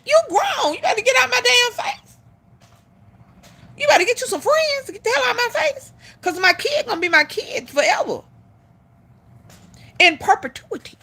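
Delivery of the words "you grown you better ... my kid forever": sharp high yell, get out of my face; angry clapback. Retold or imitated. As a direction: bark it like a fed-up mom telling him off. Mother snapping back at partner.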